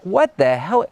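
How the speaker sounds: high-pitched, indignant outburst at no extra volume; retold anger. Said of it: "like, angry and like".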